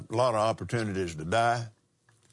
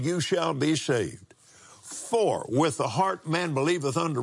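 preaching; 6 semitones higher than usual. Acted. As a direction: proclaim each phrase to the congregation. Preached scripture, raised.

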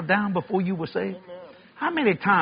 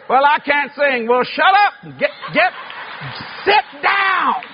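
mock shout; 7 semitones higher, slower than usual. Mocking bad singers, shouted.

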